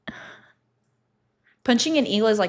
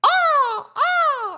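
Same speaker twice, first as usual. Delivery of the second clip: high shrill screech; loud bird call imitation; imitated eagle cry; 6 dB louder than usual. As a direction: squawk like an eagle overhead.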